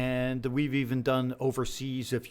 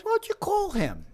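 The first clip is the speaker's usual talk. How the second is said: higher, urging buddy voice; imitating the buddy. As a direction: push him like the friend did. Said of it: "He goes".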